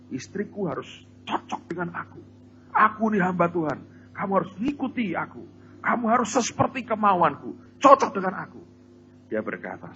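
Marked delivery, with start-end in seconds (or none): 0.1-8.6 mocking a selfish husband. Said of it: "Selama ini kamu berkata".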